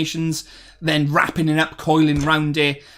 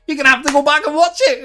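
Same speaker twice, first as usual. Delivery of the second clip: much higher, quick mock voice; silly high voice; 10 semitones higher, 3 dB louder, faster than usual. Shown as a demonstration. Streamer teasing Derek again, high goofy put-on voice. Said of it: "repeats in another voice".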